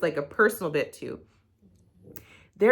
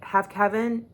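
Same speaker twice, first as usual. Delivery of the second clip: ordinary delivery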